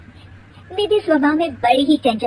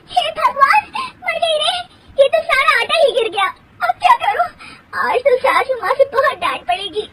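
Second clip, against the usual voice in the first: wailing; 13 semitones higher than usual. Acted. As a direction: cry out in comic despair. Flour falls on bahu, wailing.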